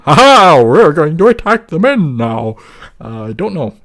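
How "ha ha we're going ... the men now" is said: hammed-up cartoon villain cry, high and loud; mock villain. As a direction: play a silly conqueror laughing at the men. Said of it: "wear it around their heads and go".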